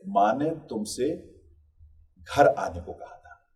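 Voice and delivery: long pause before घर, then घर आने slow and deliberate; slow lead-in, break, weighted घर आने; stress on घर. Shown as a demonstration. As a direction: hold back after तुमसे, then set घर आने apart. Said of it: "कहीं और जाने को नहीं कहा था".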